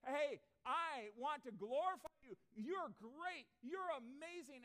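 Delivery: loud, slow, high shout of praise; exultant cry of thanks to God; joyful shout. Acted. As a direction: shout it out like a fan after a touchdown. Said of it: "And he's shouting out".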